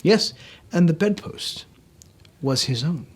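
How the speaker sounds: usual speaking voice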